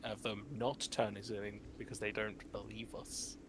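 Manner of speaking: normal talking voice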